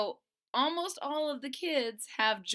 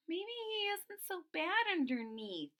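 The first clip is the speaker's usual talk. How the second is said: hopeful Stego; 9 semitones higher than usual. Acted. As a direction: wonder aloud gently. Friend Stego voiced.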